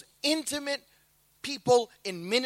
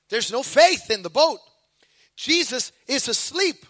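ordinary talk

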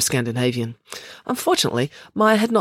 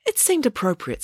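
higher-pitched, playful mischief; mischievous; 8 semitones higher than usual. Acted. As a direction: say it with sly amusement. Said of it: "said Loretta mischievously".

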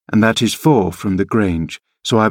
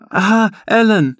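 put-on much higher, slow, girlish delighted cry; 12 semitones higher than usual. A narrator's reading. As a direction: call out to her nurse with glee. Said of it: "she cried, gaily".

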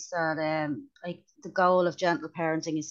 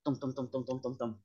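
imitated thuds; 5 semitones lower, 9 dB quieter than usual. Child tumbling down the stairs.

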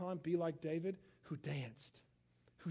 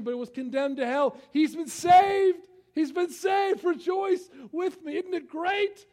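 much higher and louder, slowed shouts on the key words; shouting preaching; 8 semitones higher, 13 dB louder than usual. Acted. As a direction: rouse the whole church to joy. Preaching at full force.

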